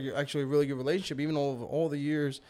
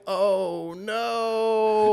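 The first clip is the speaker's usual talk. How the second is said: loud, higher drawn-out dismay; 7 semitones higher, 4 dB louder than usual. Retold or imitated. Uncle learning niece was forgotten, loud dismayed reaction. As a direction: let the dismay ring out loudly.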